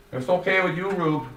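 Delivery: a hint of polite young Joey; Joey's voice. A narrator's reading.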